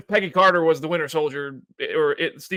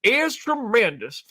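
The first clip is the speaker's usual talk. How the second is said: put-on old southern gentleman; 9 semitones higher, 4 dB louder, slower than usual. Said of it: "you don't have to say you declare".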